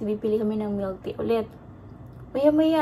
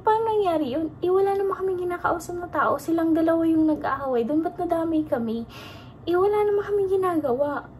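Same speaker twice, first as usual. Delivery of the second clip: agitated disbelief; 7 semitones higher than usual. Real, not acted. Reliving shock after the attack.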